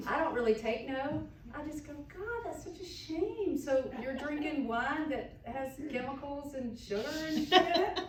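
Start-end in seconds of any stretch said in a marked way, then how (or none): 2.1-8.0 mock shocked pity. To a wine critic, sugary mock pity. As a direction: fake dismay pitched way up. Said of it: "I don't say it quite like that".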